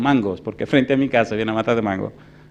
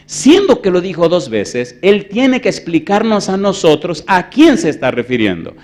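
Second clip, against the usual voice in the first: slow and weighty, building to a loud, higher push; emphatic preaching; 6 semitones higher, 10 dB louder, slower than usual. Real, not acted. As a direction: press the argument on the congregation. Preaches on the verse. (predicación enfática, cada vez más fuerte)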